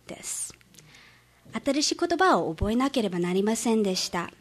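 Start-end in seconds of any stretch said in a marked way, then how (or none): none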